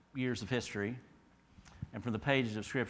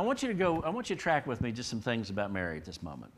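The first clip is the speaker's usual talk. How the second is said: usual speaking voice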